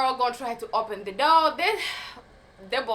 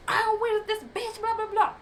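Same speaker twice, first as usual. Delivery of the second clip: high, shrill-free mock ranting voice, much higher; crazy woman impression; 11 semitones higher than usual. Imitating the raging girlfriend hunting for her, much higher. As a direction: squeal it like a crazy girl hunting someone. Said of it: "you know how crazy people go".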